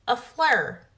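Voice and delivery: flower said as a flare; flower turned into a flare like a toddler; child's mispronunciation. Retold or imitated. Imitating a toddler naming the flower to her mom. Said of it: "F L A R".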